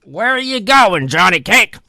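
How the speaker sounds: well diggers calling to a passerby. Acted. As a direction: call out the question from a distance. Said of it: "looked up from their work and called out".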